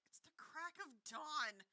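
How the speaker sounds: slow croaky sleepy voice; croaking. A narrator's reading. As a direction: croak it out, half asleep. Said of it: "Harry croaked".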